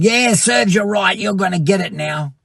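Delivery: mock a spiteful god; a taunting, mocking I-told-you-so; mock gloating. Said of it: "Is God saying".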